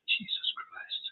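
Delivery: awed whisper. Real, not acted. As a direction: breathe it out in awe. Awed at the statue size.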